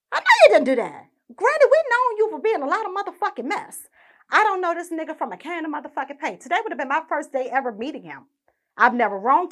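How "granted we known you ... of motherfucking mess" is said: usual speaking voice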